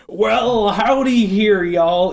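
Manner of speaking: high, loud mock-Southern drawl; hammed-up cowboy-style Southern American; bad Southern accent. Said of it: "No, that's the Southern accent".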